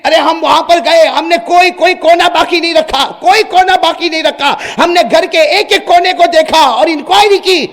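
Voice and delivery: police insisting, high and loud; loud protest. Acted. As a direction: defend yourselves loudly to the king. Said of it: "جا کر کہا". (Police کا زور دار اصرار)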